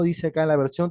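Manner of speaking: ordinary talk, nothing marked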